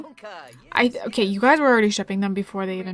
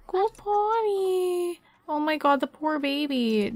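very slow, high, soft coo of go potty; drawn-out high pet-talk coaxing; 3 semitones higher, 4 dB quieter, slower than usual. Real, not acted. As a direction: coax the puppy tenderly. Cooing to the dog.

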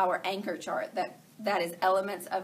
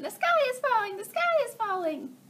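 much higher, squeaky chick chant; panicky chicks; 13 semitones higher than usual. Acted. Little chicks cry to their mother that the sky falls, high squeak. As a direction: pitch way up like tiny panicked chicks. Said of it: "her little chicks are saying".

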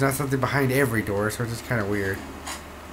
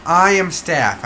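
loud, drawn-out, higher-pitched plea; mock protest; 6 semitones higher, 8 dB louder, slower than usual. Player protesting to the game that he has a card, mock protest. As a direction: protest slowly and loudly, pitched up.

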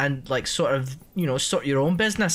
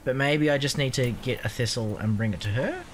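ordinary talk

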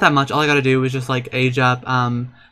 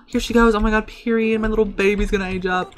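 excited gushing; 6 semitones higher than usual. Real, not acted. Gushes as Sim ages up.

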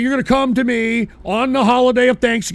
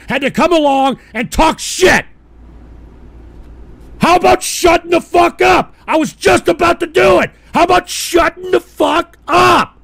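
higher and louder, building to screaming; furious shout; 7 semitones higher, 6 dB louder than usual. Real, not acted. Streamer screaming at chatter President Jay to shut up. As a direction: scream him down in rage.

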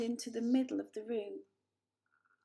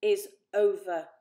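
spat; 11 dB louder than usual. A narrator's reading. Father dismissing the elder Poor, spitting with anger. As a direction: bite off each word. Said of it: "spat Father".

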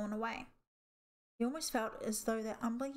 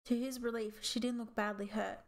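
plain reading voice

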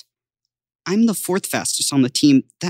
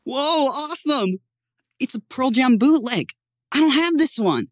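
higher-pitched, thrilled gift reaction; excited boy; 5 semitones higher than usual. Acted. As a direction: gush over an awesome present. Boy delighted by birthday gift.

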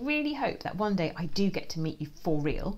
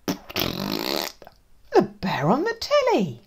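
slow blaming; 4 dB louder, much slower than usual. Acted. Blaming the telly bear.

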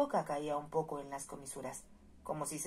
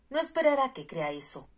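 higher, louder, angry retort; violent outburst; 8 semitones higher, 7 dB louder than usual. Acted. The marquis flares up at Melinda, angry and loud. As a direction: snap it back at her in disbelief. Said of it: "dijo con violencia".

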